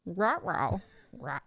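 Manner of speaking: ruh-roh in a cartoon dog voice; playful cartoon dog talk; Astro the dog. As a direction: mangle it like Scooby the cartoon dog. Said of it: "talking like Astro".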